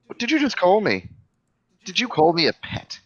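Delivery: indignant. Acted. Game master's character confronts the one who insulted him, indignant. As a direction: take affront, voice rising.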